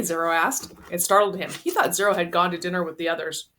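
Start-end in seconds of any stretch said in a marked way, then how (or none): none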